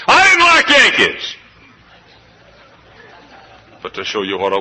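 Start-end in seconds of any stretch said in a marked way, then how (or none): 0.1-1.3 high, loud, hammed-up yell; mock outburst. Pastor joking to his northern church, hammed-up loud yell. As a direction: blurt it out like a wounded Texan.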